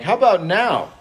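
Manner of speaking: mock princes pressing again; princes' pushy ask. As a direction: needle him like pushy envoys. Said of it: "they're like".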